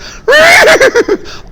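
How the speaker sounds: a sudden high squeal of a laugh; shrieking laugh mid-story. A narrator's reading. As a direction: burst out in a shrill giggle. Laugh heard, high and far.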